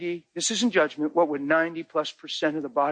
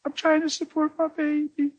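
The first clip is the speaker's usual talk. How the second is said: crying woman; 12 semitones higher than usual. Retold or imitated. Woman crying to the pastor about her babies, high and tearful. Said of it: "She just started crying".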